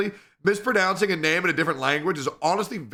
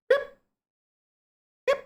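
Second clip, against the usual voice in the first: very high sing-song call; calling a cat. Real, not acted. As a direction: call the cat's name up high.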